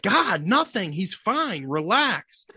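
exasperated wife. Retold or imitated. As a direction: imitate his wife brushing off his worry. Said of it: "she's like".

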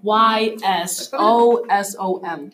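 spelled letter by letter, slowly; slow spelling out. Shown as a demonstration. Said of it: "spelling the word out".

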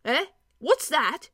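a hearty dwarf picking up the thread. A narrator's reading. Dwarf character voice.

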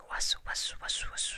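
whispered snake slither; hissy, rhythmic slithering sounds; slithering noise. A narrator's reading. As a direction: imitate a snake sliding past. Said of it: "who came slithering".

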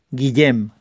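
Guillem with ll as a y-like sound, "gui-yem"; Valencian Guillem. Shown as a demonstration. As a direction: say the middle of Guillem with a palatal ll. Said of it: "en valenciano es Guillem".

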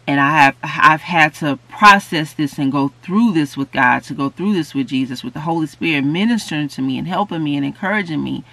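ordinary talk

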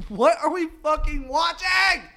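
high, loud, incredulous yell; appalled shout. Real, not acted. Podcaster appalled by the scene they watched, incredulous shout. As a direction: shout it in comic disbelief.

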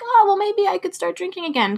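high, airy voice of the craving; mock the sly little voice of temptation; tempting inner voice. Voicing the craving that creeps into her brain, high and put-on. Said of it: "any sort of inkling of".

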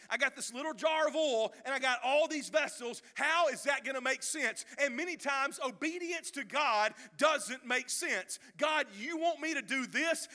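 loud, rising preacher's cry; preaching at full force. Acted. Preacher urging the church to obey, loud and rising. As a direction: preach it hard to the crowd.

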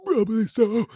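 high, loud strained throat voice; sick throat voice. Real, not acted. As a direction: squeeze it out of a sore throat. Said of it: "sick throat voice".

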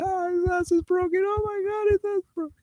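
very high squealing mock-laughing voice; imitated helpless laughter. Lieutenant laughing at the inmate for a minute, imitated helpless laughter. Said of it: "This guy's laughing".

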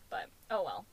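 ordinary talk

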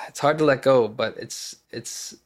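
normal talking voice

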